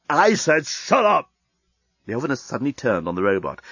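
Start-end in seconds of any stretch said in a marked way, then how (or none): 0.1-1.3 higher, slow, bitten-off angry order; angry shout. Acted. Leovinus snapping at the fussing robot, slow angry shout. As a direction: slam each word down.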